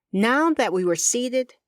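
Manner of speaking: ordinary delivery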